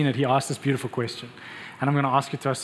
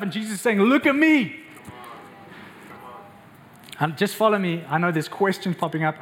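full-force preaching; 7 semitones higher than usual. Acted. Pastor preaching passionately to the congregation. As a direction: preach it hard to the whole church.

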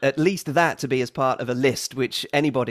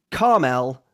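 Carmel squeezed into two syllables, car-mel; American carmel; 4 semitones lower, 4 dB louder than usual. Shown as a demonstration. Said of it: "the second version is American".

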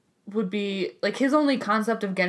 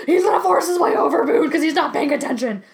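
alarmed; 7 semitones higher, 7 dB louder than usual. Retold or imitated. Retold alarm in the car.

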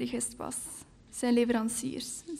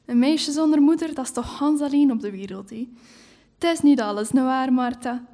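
pitying neighbour; 7 dB louder than usual. Acted. Neighbour pitying the orphan girl.

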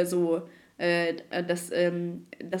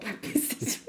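muttered retort; 8 dB quieter, slower than usual. Retold or imitated. Her retort, retold.

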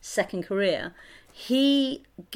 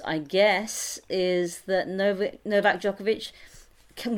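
normal talking voice

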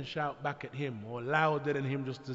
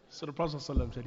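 usual speaking voice

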